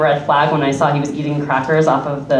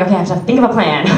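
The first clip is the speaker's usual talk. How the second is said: panicked inner voice; much faster than usual. Retold or imitated. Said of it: "and I'm like".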